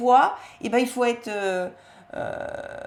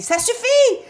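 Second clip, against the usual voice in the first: high, loud snapping yell; the fed-up big sister finally explodes; mock shout of an exasperated sister; 12 semitones higher, 9 dB louder than usual. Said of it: "Et elle lui crie dessus".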